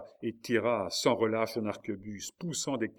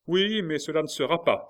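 loud, high, urgent interruption; passionate cry; 7 semitones higher, 8 dB louder than usual. A narrator's reading. Marguerite breaking in to save her husband, impassioned cry. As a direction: burst in with fervent refusal. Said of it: "s'écria une autre voix haletante et passionnée".

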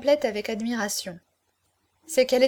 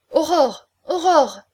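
high, loud name call; urgent interruption; 5 semitones higher, 7 dB louder, slower than usual. A narrator's reading. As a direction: cut in sharply to stop her. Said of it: "interrompit Dona Cruz". (appel fort pour l'interrompre)

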